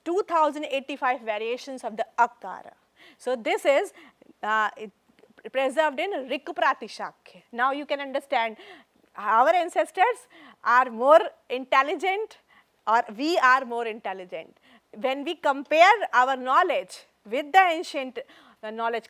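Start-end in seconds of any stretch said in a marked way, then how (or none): none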